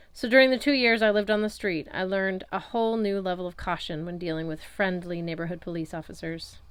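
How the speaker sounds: ordinary delivery, nothing marked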